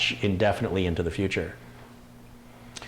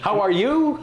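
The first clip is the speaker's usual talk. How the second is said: high, bright fake-polite "how are you"; mock high greeting; 10 semitones higher, slower than usual. Teasing the British greeting, a mock high sing-song.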